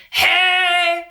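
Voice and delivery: high, loud demanding hey; assertive growl scream. Shown as a demonstration. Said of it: "very assertive, very aggressive".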